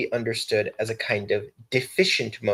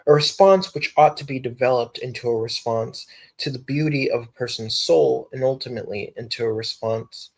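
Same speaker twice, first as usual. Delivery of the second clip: ordinary talk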